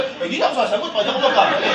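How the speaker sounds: laughing mock whine of a know-it-all; mocking quote. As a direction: tease them in a silly voice. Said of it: "Kasi sabi nga".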